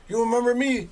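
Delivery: high-pitched imitated question; eager recognition. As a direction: prod an old acquaintance to recognize you. Prisoner greets him, retold.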